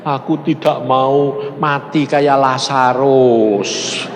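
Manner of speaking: slow, drawn-out lament to God; imitated tearful plea. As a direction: plead wearily, as if crying, stretching the words. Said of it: "Dia nangis, dia bilang". (meniru ratapan memelas)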